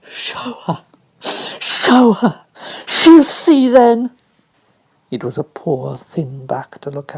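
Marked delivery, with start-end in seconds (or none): 0.0-4.1 high, slow, sob-broken demand; defiant crying boy; gasped between sobs. Acted. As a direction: gasp it out through sobs. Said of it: "gasped out between two sobs".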